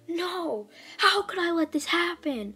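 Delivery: high-pitched mock outrage; acted angry outburst. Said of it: "super pissed, super mad".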